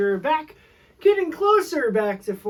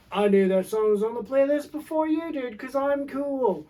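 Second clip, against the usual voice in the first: hipster voice; 4 semitones higher than usual. Pretend. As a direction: play a know-it-all hipster, higher. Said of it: "That's my hipster voice".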